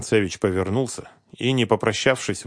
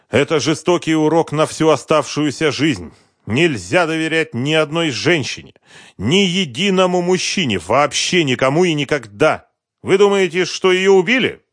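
bitter betrayed husband; 9 semitones higher, 8 dB louder than usual. A narrator's reading. Husband lamenting his wife's affair, bitter. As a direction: dwell on the insult, voice raised.

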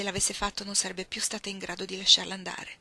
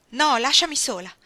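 loud, raised-pitch rebuff; angry refusal; 6 semitones higher, 10 dB louder than usual. Acted. Iggy to Ruth through the closed door, angry refusal. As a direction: push her away with your voice.

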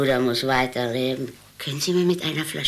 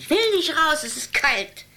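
imperious grande dame; 11 semitones higher than usual. Acted. Old woman refusing to leave.

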